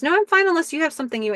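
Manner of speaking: high and fast, eager to get going; quick eager reply. Retold or imitated. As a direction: rush it out brightly. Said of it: "She's a little taken aback".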